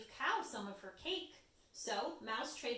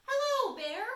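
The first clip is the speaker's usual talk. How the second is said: bright, high, louder mouse greeting; high little mouse; 12 semitones higher, 10 dB louder than usual. Acted. Mouse greeting Bear, high and loud.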